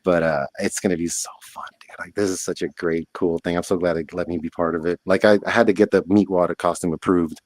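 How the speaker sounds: ordinary talk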